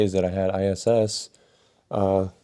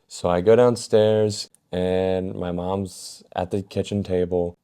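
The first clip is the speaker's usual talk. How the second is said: ordinary talk, nothing marked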